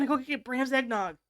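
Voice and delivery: normal talking voice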